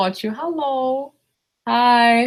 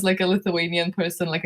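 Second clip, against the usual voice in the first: normal talking voice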